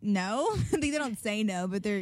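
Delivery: ordinary talk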